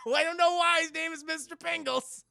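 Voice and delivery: high, loud, tickled delivery; amused high-pitched exclamation; laughing. Real, not acted. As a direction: burst out laughing into the words. Cracks up at the name.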